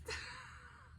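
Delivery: a high teary laugh-sob; crying laugh. Real, not acted. Mom overcome telling viewers about her son, laugh-sob. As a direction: laugh through happy tears. Proud mom recounting son's grade.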